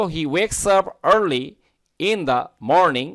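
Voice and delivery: American reading, r sounded in 'early' and 'morning'; American accent. Shown as a demonstration. Teacher modelling the sentence for learners, American accent. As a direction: read it American with clear r's. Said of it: "American উচ্চারণ".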